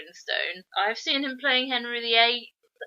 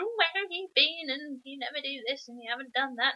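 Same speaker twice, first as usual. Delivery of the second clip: higher, softer mock nagging; nagging wife; 5 semitones higher, 4 dB quieter than usual. Mrs Beaver scolding her husband, mock nagging. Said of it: "She comes out nagging Beaver".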